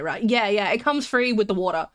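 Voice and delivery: normal talking voice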